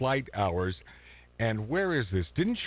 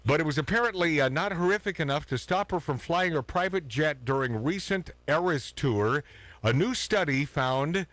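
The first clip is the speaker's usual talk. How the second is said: ordinary talk, nothing marked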